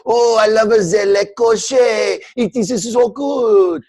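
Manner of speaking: a rich buyer gushing in a put-on French accent; bad French accent. Rich French buyer gushing over a carriage, in a bad mock French accent. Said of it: "I don't think that was a good French accent".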